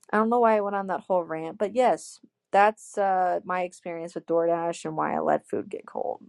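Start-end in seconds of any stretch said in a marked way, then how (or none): none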